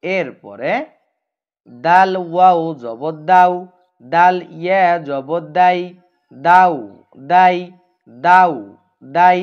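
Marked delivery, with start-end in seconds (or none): 1.7-9.4 each হাউ and হাই said loud, clear and drawn out; teacher chanting the drill loudly; clear recitation drill. Shown as a demonstration. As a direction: drill the syllables loud and distinct for pupils to repeat. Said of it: "স্পষ্ট করে বলবো".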